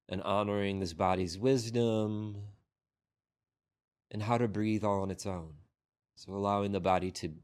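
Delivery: unhurried meditation guidance with long pauses; guided meditation. Real, not acted. Teacher guiding a group into breath and body scan, unhurried meditation voice. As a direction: lead the sitters gently, phrase by phrase, leaving long silences.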